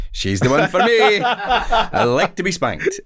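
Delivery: very high, slow, drawn-out glee; mock smitten. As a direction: crow it loudly in hammed-up delight. Mock delight at tough agent's rebuff.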